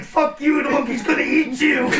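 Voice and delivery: high, loud mocking shout; gleeful shout. Crew inside the vehicle taunting the officer, in a gleeful shout. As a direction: yell it back through the hatch, delighted. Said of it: "It's like".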